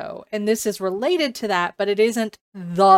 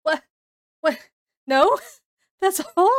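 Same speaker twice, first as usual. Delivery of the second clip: rising incredulous what, then a laugh; high disbelieving that's all; surprised disbelief; 7 semitones higher, 5 dB louder than usual. Retold or imitated. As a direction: act out shock at the ending. Reacting to a book's ending.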